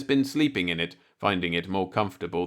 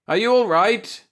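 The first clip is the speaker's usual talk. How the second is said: frantic yell, higher and louder; 10 semitones higher, 5 dB louder than usual. A narrator's reading. Husband bursting in, yelling for his wife. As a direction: call out in a panic for your wife. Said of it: "I went charging in the back door, yelling".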